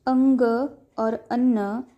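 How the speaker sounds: अंग और अन्न spoken slowly, each word drawn out; slow demo words. Shown as a demonstration. Teacher showing a nasal sound to students, slow careful demo. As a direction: stretch अंग और अन्न out for the learner. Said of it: "गले और नाक से उच्चारण करने पर".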